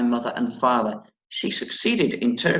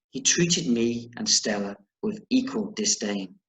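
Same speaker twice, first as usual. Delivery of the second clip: usual speaking voice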